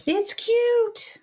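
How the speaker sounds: high, delighted gasp; excited squeal. Real, not acted. Gasp at finished card.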